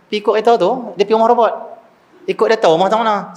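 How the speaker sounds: imitating a kid explaining the obvious, high; insistent child. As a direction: spell it out like an insistent little kid.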